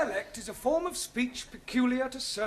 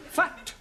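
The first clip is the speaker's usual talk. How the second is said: "Fat" said higher and louder, crisp vowel; stressed correction; 5 semitones higher, 3 dB louder than usual. Shown as a demonstration. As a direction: punch the word "Fat" clearly. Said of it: "No, not fat".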